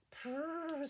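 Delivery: rolled purring cat noise. A narrator's reading. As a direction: purr like a contented cat. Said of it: "said Wilbur".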